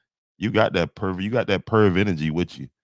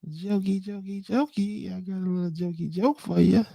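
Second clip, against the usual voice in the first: much higher, teasing squeaky voice; silly high voice; 13 semitones higher, faster than usual. Real, not acted. Streamer teasing a viewer, high mocking squeak.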